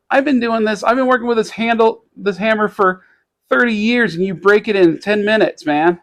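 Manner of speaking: retold exasperated complaint. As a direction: imitate an aggrieved owner scolding. Acts out hammer owner's complaint.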